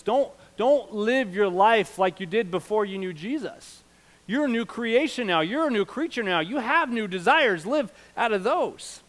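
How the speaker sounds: high, loud, deliberate exhortation; forceful preaching. Real, not acted. Pastor exhorting believers to live as new creations, forceful preaching. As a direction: urge them hard, slowing on key phrases.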